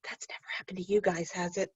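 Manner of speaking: quick hushed aside to the class; playful whisper. Real, not acted. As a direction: tease them in a fast whisper.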